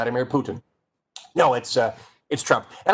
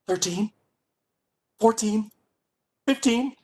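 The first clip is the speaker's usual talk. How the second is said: rising higher, barely holding back a secret; bursting with a secret; 8 semitones higher than usual. Shown as a demonstration. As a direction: squeal as if you can't keep the secret. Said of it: "you got a secret ... but you can't".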